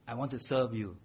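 ordinary delivery

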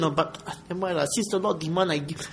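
soft, resigned mock giving-in; grudging resignation. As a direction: give in quietly and reluctantly. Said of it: "what is meant by grudgingly".

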